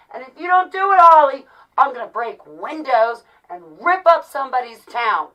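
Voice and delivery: loud, indignant imitation of a threat; mock threatening caller. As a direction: shout the threat as the demanding caller. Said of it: "for them to come tell me".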